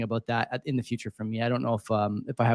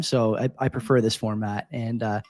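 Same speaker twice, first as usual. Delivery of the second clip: ordinary talk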